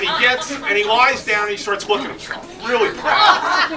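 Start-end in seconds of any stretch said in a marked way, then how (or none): 2.7-3.7 laughing. Real, not acted. Laughing at dog's pride.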